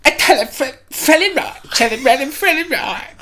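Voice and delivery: very high, loud squeaky rap gibberish; hammed-up high-pitched female rapper flow. Doing an impression for the co-host to guess, high rapper voice. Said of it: "That was my [name] impression".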